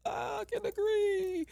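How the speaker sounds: high, slow, crying squeak of agreement. Retold or imitated. Hearing women's stories, she answers in a high crying voice. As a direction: let the voice crack into a cry, slowly.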